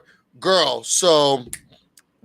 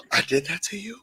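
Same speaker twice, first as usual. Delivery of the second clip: softer, hushed apologetic demo; soft mock remorse; 2 semitones lower, 7 dB quieter than usual. Shown as a demonstration. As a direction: sound sorry, drawing yourself back. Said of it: "And you have to say".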